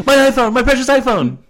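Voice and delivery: high, loud laughing wail; mock wail. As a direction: wail like Gollum over it. Wailing over the iPhone.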